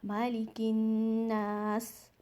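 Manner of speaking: slow मालिकिन्नास ending on a clear स; stop on seen. Shown as a demonstration. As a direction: stop cleanly on the स. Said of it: "सीन की एक sound last में आएगी".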